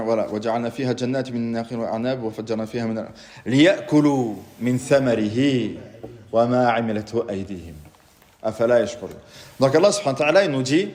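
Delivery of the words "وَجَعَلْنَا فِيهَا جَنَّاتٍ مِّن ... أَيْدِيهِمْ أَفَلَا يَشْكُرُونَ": fast, rhythmic recitation of the verse; Quran recitation. Shown as a demonstration. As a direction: rattle off the verse from memory, quick and even.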